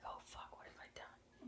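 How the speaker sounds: hushed, breathy, alarmed inner voice; panicked whisper. Retold or imitated. Panicking to herself after the director's call.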